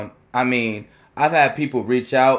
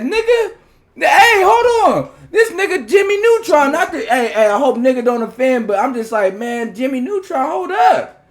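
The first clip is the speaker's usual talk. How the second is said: high, loud, laughing disbelief; cracking up with shock at the news; excited laughing; 11 semitones higher, 6 dB louder than usual. Retold or imitated. Retells his stunned, laughing reaction.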